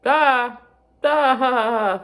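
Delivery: high, loud "ah" outburst; loud cry. Real, not acted. Sudden cry after admitting age gap.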